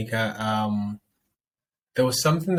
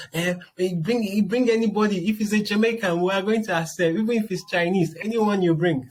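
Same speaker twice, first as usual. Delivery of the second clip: high pleading mother voice, louder; imitating her mom; 10 semitones higher, 3 dB louder than usual. Daughter mimicking her mom, begging her to bring anybody home. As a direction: beg like a mom desperate for a wedding. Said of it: "a call from my mom".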